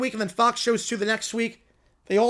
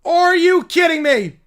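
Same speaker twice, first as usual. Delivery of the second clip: stunned disbelief; 5 semitones higher, 6 dB louder, slower than usual. Real, not acted. Reacting to upset live.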